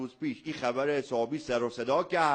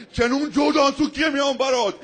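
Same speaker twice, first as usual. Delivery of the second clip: a higher, louder hammed-up threat, breaking into a laugh; mock threat shout; 9 semitones higher, 8 dB louder than usual. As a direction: bark a playful kung-fu threat. Mock kung-fu threat at friend. (تهدید شوخی با فریاد بلند)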